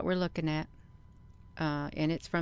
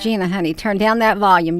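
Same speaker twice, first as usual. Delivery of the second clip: loud call across the room, raised pitch; loud shout over the noise; 3 semitones higher, 12 dB louder, faster than usual. Real, not acted. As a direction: yell to Gina to turn the volume down. Yelling at Gina over video noise.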